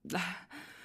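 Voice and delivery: short breathy exhale after drinking; satisfied sigh. Acted. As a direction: let out the breath after a long gulp. Drains another glass.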